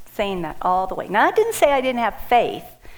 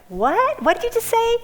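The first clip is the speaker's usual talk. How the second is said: shocked, much higher and louder; freaking out; 14 semitones higher, 3 dB louder than usual. Retold or imitated. Hearing her donor wants to meet her, a high shocked cry. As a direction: jump way up in disbelief. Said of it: "I'm freaking out".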